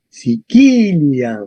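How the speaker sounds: "Sicilia" with hard k, si-KI-li-am, KI stretched; stress on KI. Shown as a demonstration. Teacher confirming the accent, KI stretched in "Sicilia". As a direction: slowly lengthen the second syllable of "Sicilia", said as KI. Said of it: "correcto, en qui".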